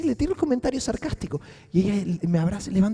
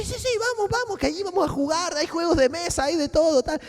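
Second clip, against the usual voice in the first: put-on higher, sing-song talk to a little kid; childlike voice; 8 semitones higher than usual. Coaxing the girl to come home, higher childlike voice. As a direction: coax her like a small child. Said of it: "como una niña pequeña".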